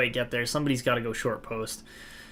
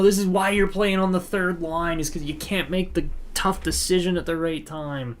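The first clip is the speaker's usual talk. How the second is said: annoyed fan scolding his winger; frustrated rant; 6 semitones higher than usual. Real, not acted. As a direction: chew him out for not shooting. Berating player for not shooting.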